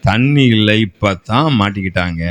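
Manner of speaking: ordinary talk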